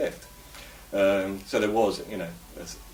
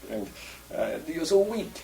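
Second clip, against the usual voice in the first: much higher-pitched mock voice of a Russian; imitated Russian acquaintance; 13 semitones higher than usual. Russian telling the English they are too polite, in a high imitated voice. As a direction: play the Russian teasing, pitched well up. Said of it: "a Russian did say to me".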